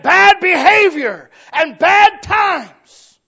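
loud, slow, soaring preacher's cry; preaching at full force. Acted. Preacher lifts the congregation toward heaven, shouting slowly. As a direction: build to a roaring, drawn-out climax.

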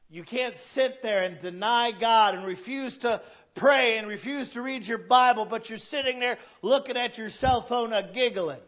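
loud, high scolding from the pulpit; full-force preaching. Real, not acted.

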